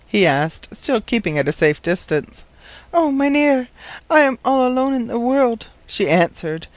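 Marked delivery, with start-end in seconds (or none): 2.9-5.7 put-on high-pitched, forlorn young girl; plaintive and high, close to tears; tearful. A narrator's reading. Orphaned girl telling the professor she is alone, tearful. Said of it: "her blue misty eyes filled with tears".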